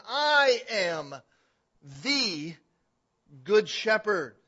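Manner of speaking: "I am" loud and high, then "the" set apart by pauses; emphatic declaration. Retold or imitated. Preacher voicing Jesus in verse eleven, "the" isolated emphatically. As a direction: declare "I am... the... good shepherd", each part landed hard. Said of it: "Here he's saying emphatically".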